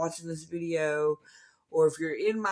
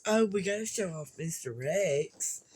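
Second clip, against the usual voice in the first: ordinary delivery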